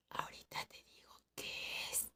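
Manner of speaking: urgent hushed whisper into the ear. Retold or imitated. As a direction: breathe it right into her ear. Secret told into her ear.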